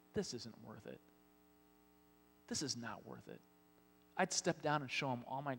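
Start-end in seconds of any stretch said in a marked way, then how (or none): 0.1-3.4 softer, lower grumble to himself; mock fed-up sigh of giving up; muttered complaint. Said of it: "I'd be like".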